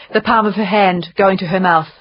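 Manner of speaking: usual speaking voice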